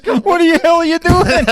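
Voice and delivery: high, loud mock protest; alarmed shout. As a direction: imitate a shocked bystander yelling out. Bystander yells at violent attack.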